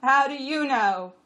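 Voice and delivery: high, loud, slow question; questioning donkey. A narrator's reading. Jack challenging the jenny, high and loud. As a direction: ask loudly, stretching it out. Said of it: "Costel asked".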